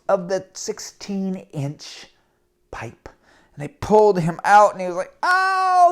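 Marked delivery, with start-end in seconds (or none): 5.2-5.9 pained yelp. Acted. Injured boy pulled out.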